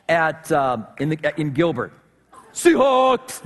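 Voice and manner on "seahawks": loud, high Seahawks fan call; mock fan cheer. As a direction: burst out with a fan's cheer. Jokingly calls out fan chant.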